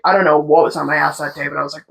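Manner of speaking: usual speaking voice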